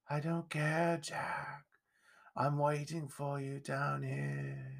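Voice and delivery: hushed, slow, eerie phantom voice; faint haunting murmur; menacing ghost. A narrator's reading. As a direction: breathe the threat very softly and slowly. Ghost answers the frightened boy.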